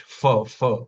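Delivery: "fa, fa" said with a plain English f; English f sound. Shown as a demonstration. Teacher showing the wrong f sound. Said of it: "the F sound in English".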